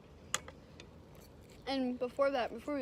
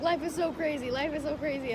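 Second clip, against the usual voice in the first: louder, higher amazed exclamation; overwhelmed excitement; 5 semitones higher, 8 dB louder than usual. Retold or imitated. Woman retelling her reaction to the proposal, excitedly overwhelmed. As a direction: relive the giddy amazement.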